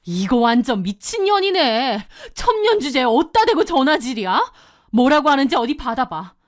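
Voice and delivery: indignant sister, incredulous and seething; outraged. Acted. Sister cursing the mistress's nerve in calling, outraged. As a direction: spit it out in angry disbelief.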